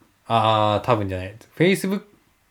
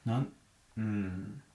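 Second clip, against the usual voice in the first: ordinary talk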